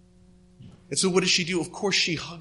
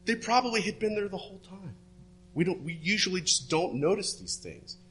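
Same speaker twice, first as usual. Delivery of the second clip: ordinary delivery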